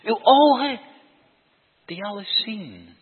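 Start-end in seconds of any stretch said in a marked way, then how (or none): 0.0-0.8 "ogen" hammered high and loud; stress on ogen. Acted. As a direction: punch the word "ogen". Preacher repeats key words emphatically. (nadruk op "ogen", hoog en luid)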